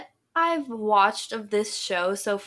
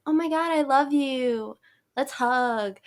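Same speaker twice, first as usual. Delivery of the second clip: higher-pitched gushing best-friend act; put-on sweetness, pitched up; fake super nice; 5 semitones higher than usual. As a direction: imitate girls pretending to adore a new friend. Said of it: "they play super nice".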